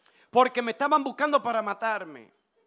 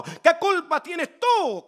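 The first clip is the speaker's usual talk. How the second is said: louder, higher, drawn-out rhetorical question; preacher's raised, slowed-down challenge; emphatic preaching cry; 8 semitones higher, 7 dB louder, much slower than usual. Real, not acted. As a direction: stretch it out and throw it at the crowd. Preacher repeats question, building for crowd.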